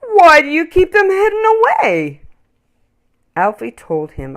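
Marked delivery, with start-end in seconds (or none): 0.0-2.2 deep friendly voice. Acted. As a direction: marvel at the toys. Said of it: "in a deep, friendly voice".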